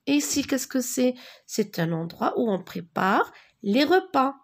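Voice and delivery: usual speaking voice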